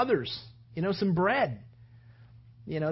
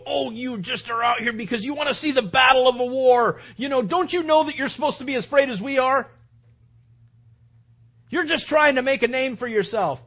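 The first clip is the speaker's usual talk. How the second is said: raised, loud, agitated telling-off; frightened scolding; 4 semitones higher, 6 dB louder than usual. Acted. Scared older brothers rebuking David to subdue him, agitated scolding. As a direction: scold him to make him back down. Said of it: "They're just frightened".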